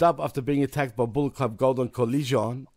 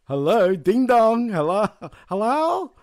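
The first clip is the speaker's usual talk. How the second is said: mocking hello; 6 semitones higher, 5 dB louder, slower than usual. Real, not acted. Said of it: "mocking someone clueless".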